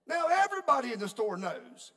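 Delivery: loud and high for the whole room; preacher to congregation, loud and high punchline; loud, high exclamation. Real, not acted. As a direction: belt it out with a laugh in it.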